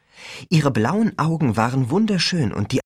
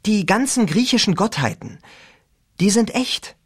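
lightly voiced teen girl; a higher girl's voice; 9 semitones higher than usual. A narrator's reading. Rachel voiced.

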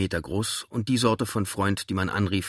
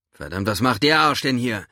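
louder, higher angry outburst; 4 semitones higher, 6 dB louder than usual. A narrator's reading. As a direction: spit out helpless rage. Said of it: "Seine ohnmächtige Wut".

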